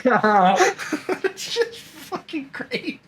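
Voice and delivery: slow and high, laughing through it. Real, not acted. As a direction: squeeze the words out while laughing. Still laughing at the joke.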